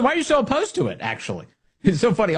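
ordinary talk

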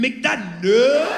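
high, very drawn-out choking caricature; hammed-up noisy passenger; mock throat noise. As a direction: join in with a stretched, strained throat voice. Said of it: "when the passenger join in".

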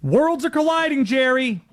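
high, loud, whiny George Costanza. Pretend. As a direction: do an upset George from Seinfeld. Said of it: "George is getting upset".

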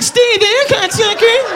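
high squeaky little doughboy; squeaky high cartoon voice. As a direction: pitch it way up like a tiny dough man. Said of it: "The Pillsbury Doughboy's like".

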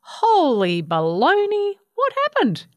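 high-pitched astonishment; shocked gasp. A narrator's reading. Cooter spots Baby's little wing, high astonished exclamation. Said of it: "Cooter spotted Baby's little wing and gasped".

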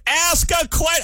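high frustrated yell; yelling at the radio. Retold or imitated. Host yelling at a radio interviewer who won't ask. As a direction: bark it at the radio in exasperation. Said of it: "I'm, like, yelling at the radio".

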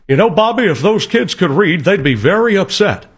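lightly voiced cartoon neighbor quoting the meme; King of the Hill neighbor. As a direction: deliver the meme line bone-dry. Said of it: "old King of the Hill meme".

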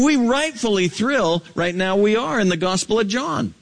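high-pitched, urgent sermon delivery; fervent preacher, voice pitched well up; impassioned preaching. Real, not acted. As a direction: preach it as a pressing charge to the congregation.

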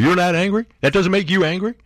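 high, rising challenge; incredulous. Real, not acted. Host challenging listeners after his grievances, incredulous. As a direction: demand to know how they can stay calm.

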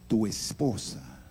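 normal talking voice

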